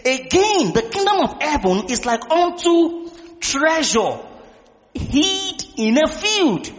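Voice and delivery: slow, drawn-out Bible reading, swelling on key words; preaching scripture reading. Real, not acted. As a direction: proclaim the verse slowly. Reads scripture aloud to congregation.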